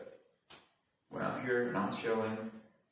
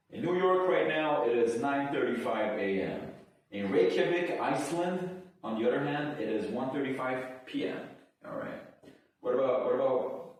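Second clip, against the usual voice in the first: higher, louder clock announcement; announcer voice; 6 semitones higher, 4 dB louder than usual. Acted. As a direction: read the times like a broadcaster. Reads world clock times.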